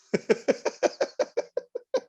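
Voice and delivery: long high giggle; real helpless high-pitched laughter; laughing. Real, not acted. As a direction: crack up in a high wheezy laugh. Cracks up at own joke.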